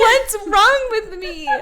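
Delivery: slow, high laughing line; laughing joke. Real, not acted. Bride joking about the wait, slow laughing. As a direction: laugh at yourself.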